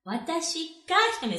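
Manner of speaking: Watashi pitched high, then falling away on Hitomi; stress on WATASHI. Shown as a demonstration. Teacher showing learners what ga emphasises, stress on Watashi. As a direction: punch Watashi, the word before ga. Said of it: "ga puts emphasis on the word before".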